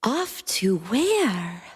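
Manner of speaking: slow, wondering and curious. Acted. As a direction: ask it with wide-eyed wonder. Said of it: "Mantis wondered".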